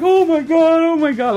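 high, loud imitated sob; hammed-up sobbing oh my God; mock sobbing. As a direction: wail it like a crying fan. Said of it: "like, sobbing".

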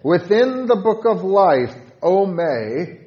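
loud and high, prayerful; fervent loud recitation of a hymn prayer; fervent hymn reading. Shown as a demonstration. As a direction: call out to Jesus from the heart. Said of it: "With stanza five".

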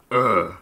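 loud guttural grunt; mock orc grunt. Player mocking the orc language with a grunt. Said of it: "in Orcish, that sounds like".

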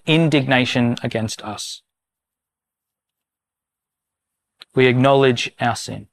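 slow, measured prayer of confession, pausing often. Real, not acted. Pastor leads the congregation in confession, slow and solemn. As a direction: lead the church through each line, earnest and unhurried. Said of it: "I'm gonna read it slowly".

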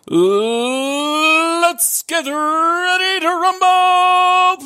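very high, drawn-out ring announcer call; wrestling announcer. Pretend. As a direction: stretch every word like a boxing MC. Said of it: "pro wrestling introduction".

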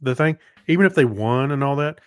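normal talking voice

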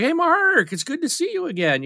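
very high mock-cheerful hello; happy greeting. X-Wing player greeting Mark again, high cheerful voice. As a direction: pitch it way up, glad to see an old friend.

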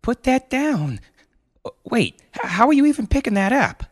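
high, panicky stammering disbelief; alarmed mother. Acted. Mother alarmed at son's boulder.

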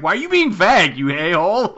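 high, loud mock scolding with a laugh; exasperated. Sansa scolding Bran for being vague, exasperated. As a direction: call him out, half laughing. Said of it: "and it's like".

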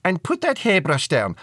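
loud, high motherly order; mum calling. A narrator's reading. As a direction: call it out to a daughter from the next room. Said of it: "her mum called".

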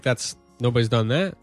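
ordinary delivery

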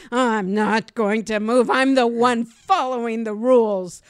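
loud, indignant refusal; defiant inner voice. Retold or imitated. To millennials on the sidewalk, loud indignant refusal. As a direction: stand your ground out loud. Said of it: "And I'm like".

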